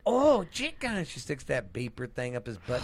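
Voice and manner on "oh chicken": high, loud, slow delighted coo; drawn-out greedy delight; Fat Bastard. Acted. Quoting the chicken-in-bed scene, high delighted impression. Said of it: "Yes, he's like".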